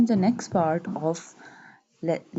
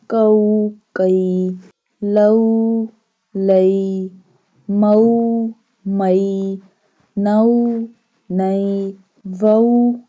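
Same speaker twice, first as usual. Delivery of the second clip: syllable drill; 6 dB louder, much slower than usual. Shown as a demonstration. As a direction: chant each letter, vowel and syllable for students to repeat.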